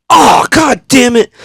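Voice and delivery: high, loud, slow groaned curse; reenacted pained, frustrated yell. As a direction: curse loudly in drawn-out dismay. Retold stepping in cat puke.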